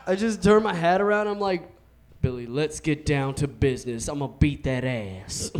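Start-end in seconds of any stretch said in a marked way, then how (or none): 2.2-5.5 hint of a swaggering TV bully; cartoon bully. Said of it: "now I'm the bully from that same T V show".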